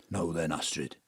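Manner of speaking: lightly voiced gruff dad; low soft dad; deep dad voice. Spirit replays dad's deep voice.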